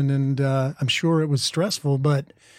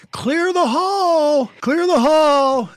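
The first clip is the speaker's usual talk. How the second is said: high, drawn-out yell down the hall; 13 semitones higher, 4 dB louder, much slower than usual. Retold or imitated. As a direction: imitate a sibling's loud, stretched call. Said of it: "they'd yell something like".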